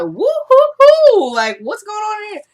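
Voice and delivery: very high, drawn-out imitated whoop; hammed-up high-pitched entrance; mock whooping. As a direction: whoop it up, then stay high for the question. Acting Eddie arriving at crime scene.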